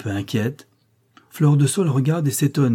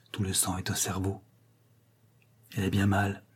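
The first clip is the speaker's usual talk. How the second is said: much softer, worried hush; low voice; 3 semitones lower, 9 dB quieter than usual. Acted. Girl checks her unconscious friend, in a low voice. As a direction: keep it down at the sickbed. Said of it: "elle dit à voix basse".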